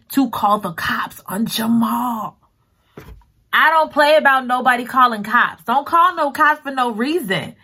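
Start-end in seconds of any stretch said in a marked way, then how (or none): 3.5-7.5 raised, indignant protest. Real, not acted. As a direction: get fired up about it. Outraged about calling cops.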